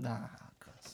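ordinary talk, nothing marked

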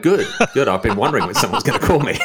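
high, bright-eyed fake enthusiasm; gushing like he's been waiting all week; mock eager delight. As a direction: play it overjoyed to get the call. Mock eager reply to scammer.

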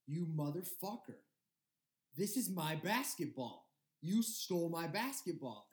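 mock-indignant accusation, mildly worked up; retold angry outburst. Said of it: "he went off for straight".

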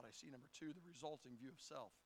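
normal talking voice

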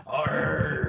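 drawn-out pirate growl; hammed-up pirate "arr". Playing pirate for his laughing friends, mock growl. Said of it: "I'm a pirate".

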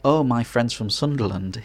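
ordinary talk

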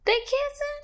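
high squeaky gasped question, pitch shooting up; a daughter catching her mom mid-kiss, asking in high shock; shocked disbelief. Retold or imitated. Daughter spotting mom with Steve, shocked high squeak.

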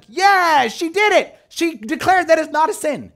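high, mock-celebratory cheering; imitated audience cheer. Studio audience applauding the girl's declaration, mock cheer. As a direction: cheer her on like a studio crowd. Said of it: "so everyone was like".

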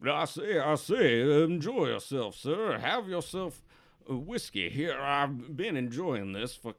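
put-on drawn-out old regular at the bar; slow, rambling old drinker; an old-timer savouring his whiskey; old barfly. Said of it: "the old guy at the bar".

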